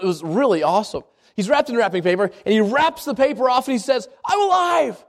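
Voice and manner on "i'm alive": mock joyful shout, pitched up; overwhelmed joy. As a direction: burst out, amazed to be alive. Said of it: "he's just overwhelmed".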